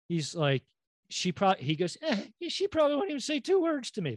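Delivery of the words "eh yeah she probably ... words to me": high, quick boyish-star impression; Michael J. Fox impression. Imitating the movie's lead griping about a girl, high quick impression. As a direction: put on a much higher, faster movie-actor voice. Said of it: "That's my Michael J. Fox impression".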